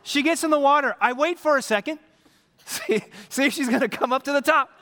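normal talking voice

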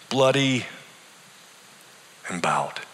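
trailing off to a whisper on bowed; defeated whisper. A narrator's reading. Dying man answering his pastor, defeated and fading. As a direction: let the last word fade to breath. Arthur's bitter variation.

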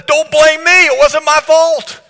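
a touch of a cornered man pleading innocence; defensive blame-shifting. Retold or imitated. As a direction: push the blame off yourself. Healed man voiced blaming another.

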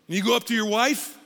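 normal talking voice

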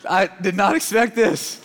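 mock-stunned, reliving the moment; stunned disbelief. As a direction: let the shock slow every word down. Stunned reaction retold at tire shop.

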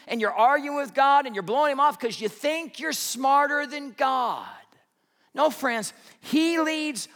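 high, loud, driving sermon build; full-force preaching. Acted. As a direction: hammer the point home to the congregation.

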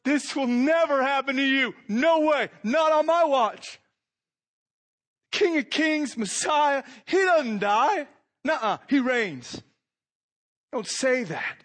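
high, loud protesting rebuke; Peter rebuking Jesus. Acted. Peter protests loudly to Jesus, high indignant rebuke. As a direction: insist hard that it will never happen. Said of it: "began to rebuke him, saying".

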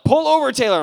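loud, high insistent order; Jesus pressing, loud and high; urgent command. Retold or imitated. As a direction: call out to make him stop the car. Jesus voiced urging him in car.

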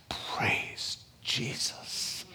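slow breathy whisper of praise. Retold or imitated. Whole group reacting to the musk, hushed whispered praise. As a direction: breathe it reverently, barely audible. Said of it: "Everybody's like".

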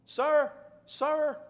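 high, loud call across the store; sharp cashier summoning a customer; young cashier calling a customer over, loudly. Retold or imitated. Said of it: "something in her tone".

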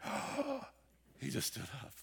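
the crowd cannot believe its eyes; amazed gasp. Retold or imitated. Crowd sees the man stand.

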